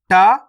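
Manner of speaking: टा said simply, without a puff; a plain, unforced consonant on टा; plain unaspirated. Shown as a demonstration. Teacher shows learners the simple letter, टा unaspirated. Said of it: "टा को simple पढ़ेंगे".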